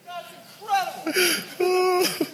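breathless laugh then slow, high wonder; gasping in amazement mid-swing; real awe. Real, not acted. Swinging out over the valley, real breathless awe.

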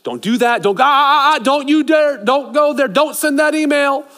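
high, loud frantic don't; urgent warning. Acted. The Spirit warning a believer off a mistake, high and frantic. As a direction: warn them off like a panicked friend. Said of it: "there's warning lights".

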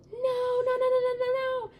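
high-pitched mock-panicked rapid protest; panicked refusal. Little girl refuses grandma's scary movie, high panicked squeal. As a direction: squeal it like a scared kid refusing.

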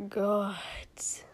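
exhausted whispered groan. Acted. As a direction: breathe it out in relief. Prince after swallowing liquor.

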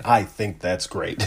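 low, slow, soft dad impression; mock dad. Teasing the co-host for how he said great, mock dad. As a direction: imitate a dad's slow, quiet approval. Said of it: "it sounds like a dad".

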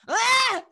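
loud, high mock sneeze; imitating a sneeze. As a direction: belt out mum's huge sneeze. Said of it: "my mum just sneezed".

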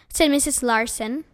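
plain reading voice

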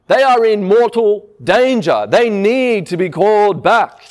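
normal talking voice

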